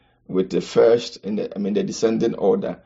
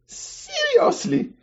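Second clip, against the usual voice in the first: much higher, slow drawn-out incredulity; mock disbelief; much slower than usual. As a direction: stretch it out in feigned shock. Reacting to money ranked last.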